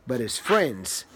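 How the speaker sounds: low, soft, drawn-out refrain; sleepy refrain. A narrator's reading. Reading to a child, the bear's refrain low and hushed. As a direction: lull it down like a bedtime refrain.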